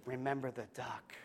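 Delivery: soft, pointed little reminder; hushed warning. Acted. Sister quietly reminds her brother of the duck, sly and hushed. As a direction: breathe it as a sly threat.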